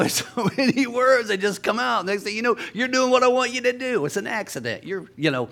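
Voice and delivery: usual speaking voice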